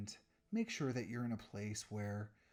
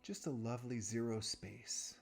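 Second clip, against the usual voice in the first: guided meditation. Real, not acted. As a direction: guide them unhurriedly through each breath, softening at phrase ends.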